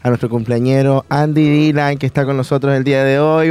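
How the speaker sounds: normal talking voice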